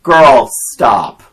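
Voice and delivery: loud, scolding yell at the TV; re-enacted shout at a movie character; retold yell. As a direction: yell it at the screen in exasperation. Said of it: "I yelled at the T V".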